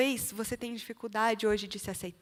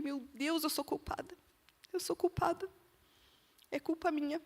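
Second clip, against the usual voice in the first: soft, higher, guilt-stricken sobbing words; crying confession; 5 semitones higher, 6 dB quieter than usual. Retold or imitated. Mother blaming herself for her son's illness, quiet crying. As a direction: break down admitting the blame. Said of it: "eu comecei a chorar".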